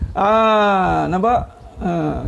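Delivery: ordinary talk, nothing marked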